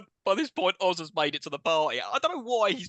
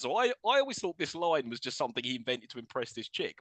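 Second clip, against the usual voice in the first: usual speaking voice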